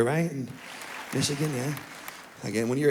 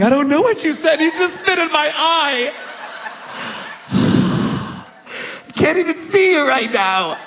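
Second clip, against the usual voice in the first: put-on crying kid; 11 semitones higher than usual.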